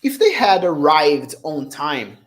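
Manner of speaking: slow, careful English example; slow model reading. Shown as a demonstration. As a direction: spell it out slowly for students. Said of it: "Eu falo assim, ó".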